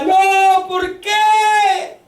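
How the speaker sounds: high anguished shout; preacher voicing José's anguished cry. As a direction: cry out in protest. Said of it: "y gritaba".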